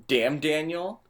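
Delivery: ordinary talk, nothing marked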